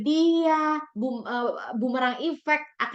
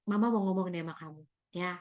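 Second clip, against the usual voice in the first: soft, serious mother's opening; serious calm mother; 3 semitones lower, 7 dB quieter, faster than usual. Shown as a demonstration. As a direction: lower the voice and open gently. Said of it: "intonasinya serius ya". (ibu bicara serius dengan lembut)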